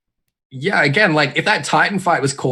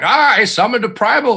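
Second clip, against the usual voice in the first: proud exclamation; 11 semitones higher, 5 dB louder than usual. Retold or imitated. Retelling their own old reaction.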